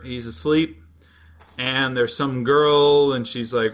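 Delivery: ordinary delivery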